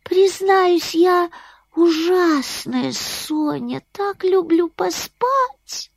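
high, slow little bat; a sleepy bat speaking sweetly; squeaky bat voice. Acted. Bat confesses.

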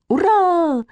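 high, drawn-out hooray; child's cheer. A narrator's reading. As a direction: cheer like an excited girl. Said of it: "крикнула Катя". (радостный высокий детский возглас)

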